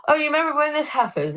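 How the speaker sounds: high, quick eager question; high, fast retold excitement. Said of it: "and you go".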